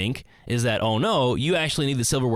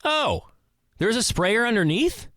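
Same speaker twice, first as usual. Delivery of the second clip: much higher, astonished oh; surprised question; 11 semitones higher than usual. Retold or imitated. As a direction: sound genuinely amazed at the news. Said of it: "the other person goes".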